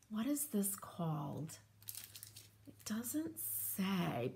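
whispered question to herself. Real, not acted. To herself while reading the package, a soft whisper. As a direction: hush it as if thinking aloud. Muttering to herself over stencils.

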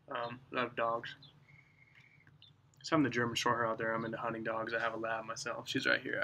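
talking around pipe stem; clenching pipe between teeth; pipe in mouth. Real, not acted. Seen 3: Pipe stem clenched in mouth from 2.2s; earlier held by nose.

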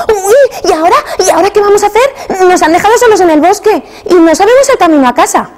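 put-on high, worried child's voice; a small boy fretting he can't find home; scared little brother. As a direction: panic lost in the woods. Brother panicking in the forest.

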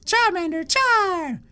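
high-pitched little creature squeal; Charmander cry. Acted. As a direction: chirp the name like a tiny monster. Voicing Charmander's cry in battle.

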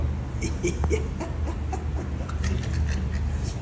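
a sudden high burst of laughter; bursting into high-pitched laughter. Real, not acted. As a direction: laugh out high at the cheap price. Laughs at the price.

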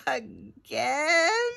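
groaned, rising question; high, drawn-out groan of dismay. Acted. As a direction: groan it in weary protest. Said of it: "groaned the hen".

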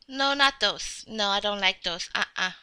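low, soft little refusal; dismissive refusal. Pretend. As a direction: turn it down quietly, half to oneself. Rejecting offered pants.

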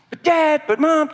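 put-on high, pleading kid voice; whiny child. Child begging Dad and Mom, whining high. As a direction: protest like a kid sent to his room. Child protests in acted scene.

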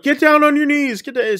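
high-pitched retold command; mock barked order. Officer with a gun orders the chef to kneel, mock barked order. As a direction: imitate a gunman ordering someone down.

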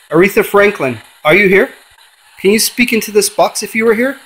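summoning a dead singer through a spirit box; calling out to a spirit. Acted. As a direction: call into the void, hoping she answers.

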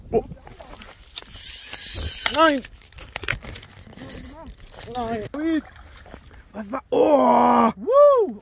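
loud, high whoop of joy; excited shout. Real, not acted. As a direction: cheer out loud. Fish caught on the line.

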